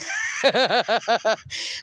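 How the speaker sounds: short run of chuckles; amused rapid giggle; laugh. Real, not acted. Amused by her own chat.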